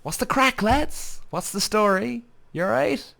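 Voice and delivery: high, sing-song Cork greeting; Cork accent. Shown as a demonstration. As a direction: lay on the Cork accent for the lads. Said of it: "But that's what we say, lads".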